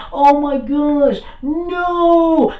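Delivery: high, loud, drawn-out mock panic; terrified villain, played big; scared Frieza. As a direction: wail it slowly in hammed-up dread. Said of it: "Frieza was scared. He was like".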